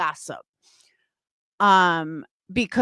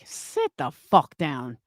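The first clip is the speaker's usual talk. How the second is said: ordinary delivery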